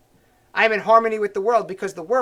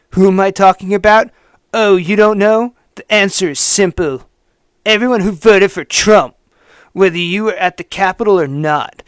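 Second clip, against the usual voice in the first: mock hater's voice; 9 dB louder than usual. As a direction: sneer it out as the smug writer. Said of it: "read it in the voice I assume he wrote it in".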